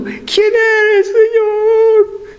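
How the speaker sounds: high, drawn-out frightened cry; fearful. Acted. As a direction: plead timidly, stretching each word. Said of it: "Todo miedoso, todo cocoinado".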